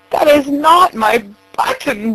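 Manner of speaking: high, wailing despair. A narrator's reading. Toad wailing to Frog about the wrong button. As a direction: wail it like a lost child. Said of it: "wailed Toad".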